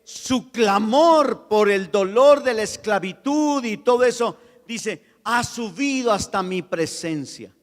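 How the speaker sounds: loud, high preaching at full force; impassioned sermon voice; fervent preaching. Acted. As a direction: preach it with rising passion.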